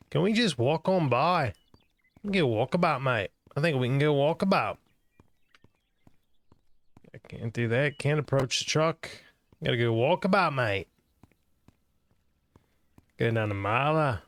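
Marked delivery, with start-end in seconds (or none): 0.1-4.8 higher, playful put-on Australian accent; mock Aussie accent. Streamer hams up an Australian accent for the trucking game. 8.0-10.9 higher, playful put-on Australian accent; mock Aussie accent. Streamer hams up an Australian accent for the trucking game.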